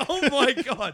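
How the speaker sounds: high, loud exclamation through laughter; laughing disbelief. Retold or imitated. Recalling a funny dinner scene, laughing disbelief. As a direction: cry out helpless with laughter.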